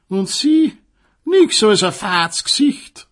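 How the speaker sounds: high, loud mock-pitying gossip; gossipy sausage. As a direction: gossip like a catty old neighbour. Selchwurst klatscht über das Paar. (hohe, tratschende Klatschbasenstimme)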